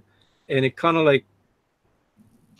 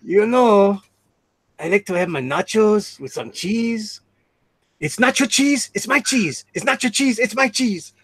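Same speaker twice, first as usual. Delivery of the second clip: high-pitched imitated Mexican accent; hammed-up Mexican accent, climbing higher on each repeat; 6 semitones higher than usual. Streamer mimicking a friend's cheese routine, mock Mexican accent. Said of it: "he does that Mexican accent".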